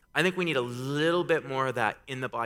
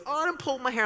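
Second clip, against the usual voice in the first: put-on child's fib. Dad acts out daughter's denial.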